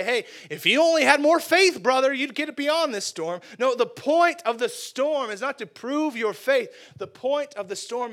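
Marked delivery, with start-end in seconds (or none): 0.0-3.4 high, loud mock sermonizing. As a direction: overdo the pious brother talk. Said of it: "the point ... is not to say".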